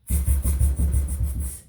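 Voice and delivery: rapid voiceless monkey chatter; sharp repeated tss like cheeky monkeys; mimicking monkeys. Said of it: "stopped both feet back at him".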